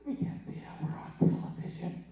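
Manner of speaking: whispered mock aside; hushed, voiceless aside; stage whisper. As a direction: whisper it like a confidential warning. Mock aside censoring himself on stage.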